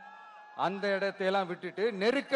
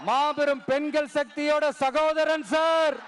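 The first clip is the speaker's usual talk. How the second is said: ringing political speech with long-held line endings; rally oratory; 3 semitones higher than usual. Real, not acted. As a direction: rouse a huge crowd, pausing for cheers. (மேடைப் பேச்சு, முழக்கமாக)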